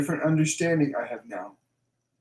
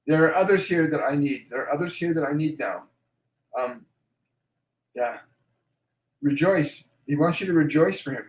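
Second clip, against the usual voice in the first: ordinary talk, nothing marked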